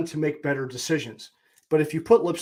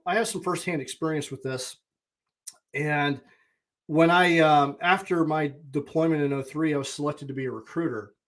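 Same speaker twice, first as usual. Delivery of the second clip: normal talking voice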